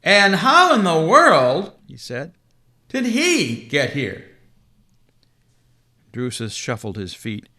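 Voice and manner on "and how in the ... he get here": slow, loud, wondering; astonished Santa. Acted. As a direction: drag it out in amazement. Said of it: "mouth opened in wonder".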